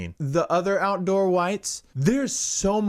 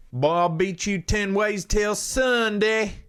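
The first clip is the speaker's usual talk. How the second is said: Southern accent, mock menace; mock Southern threat; 6 semitones higher than usual. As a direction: play a riled-up country boy with a folksy threat. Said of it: "you better watch the fuck out".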